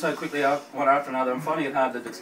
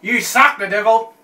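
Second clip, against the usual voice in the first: loud; 7 semitones higher, 9 dB louder, slower than usual. Real, not acted. Cursing Devil's Revenge sauce.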